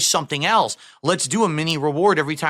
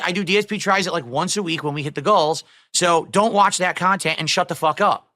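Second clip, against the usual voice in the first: ordinary talk, nothing marked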